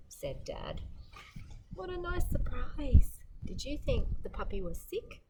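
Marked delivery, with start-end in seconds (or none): 1.7-3.2 high, loud delight. Real, not acted. Reader telling kids about the puppies, high delighted exclaim. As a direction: burst out pleased.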